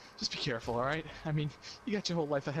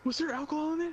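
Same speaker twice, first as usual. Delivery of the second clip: much higher interrogating mother; high mock mom voice; 13 semitones higher than usual. Mom grilling son about alcohol, high mock voice. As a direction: needle him like a suspicious mom.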